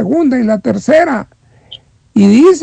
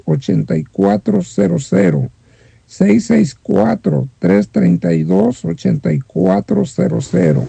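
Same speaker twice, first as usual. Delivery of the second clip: announcer read; 3 semitones lower than usual. Real, not acted. Repeats phone number slowly.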